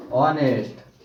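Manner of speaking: ऑनेस्ट drawn out, H silent; slow model "on-est" for ऑनेस्ट, no H; correct honest. Shown as a demonstration. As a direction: stretch ऑनेस्ट from an opening "o" vowel. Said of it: "pronunciation करेंगे तो यहाँ आएगा हमारा ऑनेस्ट".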